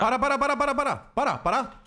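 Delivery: urgent high repeated stop; frantic calling. Real, not acted. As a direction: yell at them to stop. Frantic in-game shouting to stop.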